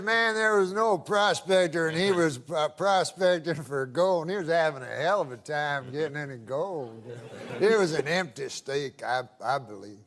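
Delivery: put-on good old boy. Good old boy telling the prospector joke, lightly voiced and low. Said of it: "That's how he talks".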